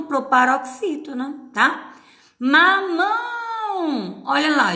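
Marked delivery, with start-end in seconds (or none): 2.4-4.2 "Mamão" very slow and high, MÃO drawn out long; stress on MÃO. Shown as a demonstration. Teacher showing the accented syllable, "Mamão" with a long high MÃO. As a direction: stretch the last syllable of "Mamão". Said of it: "já tem acento".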